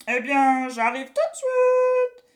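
high, loud, slow gleeful witch; a witch chuckling as she comes; clucking witch. A narrator's reading. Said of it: "gloussa-t-elle".

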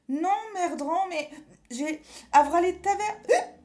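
put-on high, cheeky little boy; a little kid blurting rude words; childlike squeaky voice. A narrator's reading. Pierre cursing with witch inside.

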